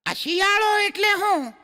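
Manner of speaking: put-on high, eager kid calling his papa; little boy's voice. A small boy calls out to his father, high and eager. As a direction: pipe up like a small curious boy.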